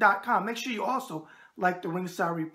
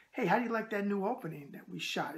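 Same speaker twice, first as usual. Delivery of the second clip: normal talking voice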